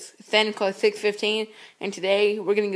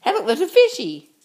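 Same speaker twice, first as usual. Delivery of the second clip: excited; 9 semitones higher, 6 dB louder than usual. Pretend. Spots the dog as fish.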